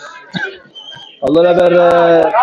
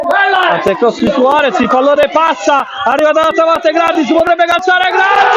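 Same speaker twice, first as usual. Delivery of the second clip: rising to a roaring goal call; breathless italian goal commentary; 9 semitones higher than usual. Real, not acted. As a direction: scream the goal, stretch the name. Goal scored, commentator erupts.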